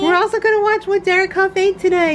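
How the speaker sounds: high, fast, laughing pet voice; laughing through the puppy voice; voicing the dog. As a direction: rattle it off for the dog. Continuing dog voice.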